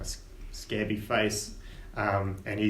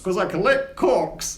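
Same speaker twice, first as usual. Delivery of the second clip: laughing gnome; 7 semitones higher, 5 dB louder, slower than usual. Acted. Gnome Corks speaking.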